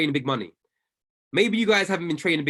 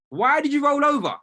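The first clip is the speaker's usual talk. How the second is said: high, loud exasperated shout; mock scolding shout; 11 semitones higher, 5 dB louder than usual. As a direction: yell it at the viewer who rolled over. Scolding the viewer about rollover.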